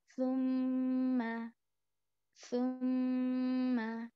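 सुम्मा with the म held in the nose, slowly; nasal ghunna. Shown as a demonstration. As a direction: hum the doubled मम in सुम्मा before releasing. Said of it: "नाक में थोड़ी देर रोक कर पढ़ेंगे". (सुम्मा में मीम को नाक में रोकें)

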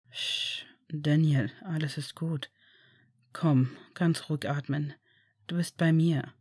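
calm him back from the nightmare; soothing father. Acted. Father soothes waking child. (tiefer, leiser beruhigender Vater)

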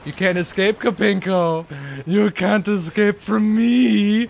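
loud, mock-menacing taunt with a drawn-out ending; hammed-up villain threat, stretching the last words; gloating villain. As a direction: play the villain hunting down prey. Taunting a fleeing game enemy, villain-like.

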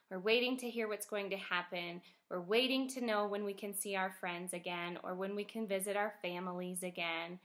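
ordinary delivery

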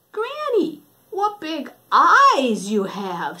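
put-on high then slow amazed girl; drawn-out child's wonder; wondering little girl. Red noticing big eyes, slow and amazed.

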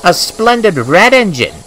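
high, indignant self-praise; vain protest. A narrator's reading. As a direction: show off with high-pitched pride. James vainly joins the complaint.

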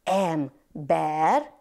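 hó-em-ber said slowly, syllable by syllable; split into syllables. Shown as a demonstration. Teacher to children, clapping out syllables slowly. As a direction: stretch Hóember out into its three syllables. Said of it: "szótagoljunk".

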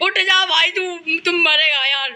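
urging teammate up; urgent shout. Real, not acted. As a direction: yell at him to move. Urging friend to get up.

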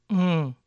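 "Mm" hummed through the nose as ng; nasal ng sound. Shown as a demonstration. Teacher demos the ng sound for learners, nasal. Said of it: "N hecha con la nariz".

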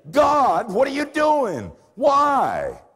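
loud, high anguished shout at God; crying out to God. Retold or imitated. Dying man shouting at God, high and loud. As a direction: demand an answer from heaven, full voice.

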